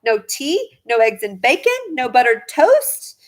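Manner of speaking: plain reading voice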